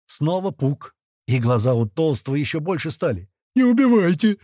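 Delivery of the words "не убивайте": much higher, loud hopeless plea; doomed plea. A narrator's reading. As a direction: beg like a doomed man. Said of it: "как-то обречённо произнёс Толстый". (обречённая мольба, высоко)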